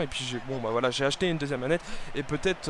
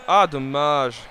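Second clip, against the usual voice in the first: loud disappointed groan; 9 dB louder, much slower than usual. Real, not acted. Reacting to a missed shot.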